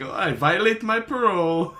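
high, loud accented impression through laughter; hammed-up comic foreigner voice, barely holding back laughs; Borat. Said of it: "wait till he says the Borat voice".

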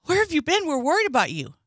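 high, frantic worried call; worried friend voice. Retold or imitated. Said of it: "Amber comes in".

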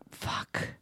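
breathless whispered swear; whispered curse. Retold or imitated. A reader's shock at a death, whispered. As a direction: hiss it under your breath. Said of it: "And I was like".

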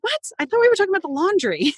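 high, quick baffled mock husband; confused husband. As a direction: mimic a lost, puzzled husband. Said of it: "And he's like".

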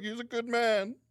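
high-pitched imitation of a mourner; mock mourning. Cohost retelling a film mourning scene, high mock grief. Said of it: "they're all mourning his death".

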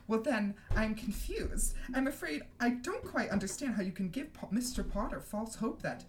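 high, affected puzzlement; Umbridge. Acted. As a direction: feign polite confusion in a simpering high voice. Umbridge feigns confusion, simpering.

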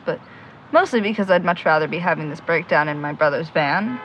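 normal talking voice

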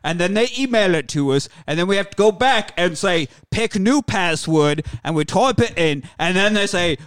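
comic rant in put-on London accent; hammed-up British film star listing grievances; Michael Caine. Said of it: "Michael Caine Bronson".

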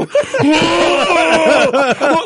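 hammed-up bawling howl; mock sobbing. Dad breaking down with daughter, exaggerated sobbing. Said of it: "like, sobbing".

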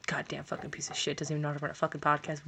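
hushed, fast muttered cursing; whispered grumble. Real, not acted. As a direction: whisper the complaint under your breath. Grumbling after volume fix.

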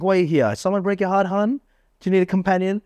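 high-pitched, playful pick-up line; mock-concerned flirting, pitched well up; teasing chat-up. Acts out his teasing chat-up lines.

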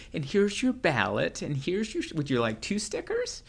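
put-on high, sweet old lady voice; sweet elderly poll worker. Elderly poll worker offering young voter a marker, high sweet voice. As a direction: coo it like a kindly grandma handing out ballots. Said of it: "elderly faces going".

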